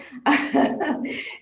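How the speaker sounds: airy, high, voiceless laugh; breathy laugh. Real, not acted. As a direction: laugh it off under your breath. Laughs at own childhood grievance.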